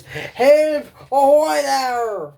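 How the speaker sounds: high, loud call across the water, slow on ahoy; pirate calling for help. Acted. Stranded pirate hailing a passing ship, loud high call. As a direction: hail the passing ship for rescue.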